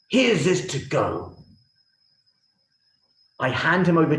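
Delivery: high, loud royal proclamation; a king passing judgement in full force; booming king, slow on the verdict; furious king. Acted. King condemns the Prime Minister.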